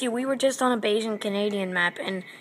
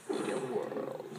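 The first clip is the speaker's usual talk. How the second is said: breathy deep trailer narrator; movie trailer voice; 10 dB quieter, slower than usual. Pretend. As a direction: start like a film trailer. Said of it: "My voice sounds so deep when I do that".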